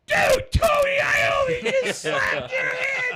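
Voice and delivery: very high, loud, slow shriek with laughter; excited fanboy shout. Retold or imitated. [name] freaking out after [name] slaps [name]'s hand. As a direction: howl it in starstruck joy. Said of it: "He was like".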